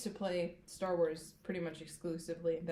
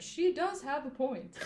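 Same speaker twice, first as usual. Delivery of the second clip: sassy; 7 semitones higher than usual. Retold or imitated. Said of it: "looked at his nails and said".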